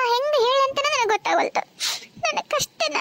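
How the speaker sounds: high, soft, sobbing voice; woman weeping as she begins to tell her troubles; crying. Acted. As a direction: break down while trying to speak. Woman starts telling her sorrow.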